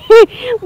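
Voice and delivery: a quick high burst of laughter; short laugh. Real, not acted. Mother laughs at failing to catch the duck, short laugh. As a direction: laugh it off, amused at yourself.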